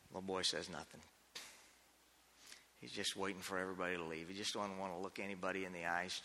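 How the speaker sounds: quiet, low sermon storytelling; somber story narration. A narrator's reading. Preacher telling the boy's shame, hushed and low. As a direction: let the sadness settle, softly.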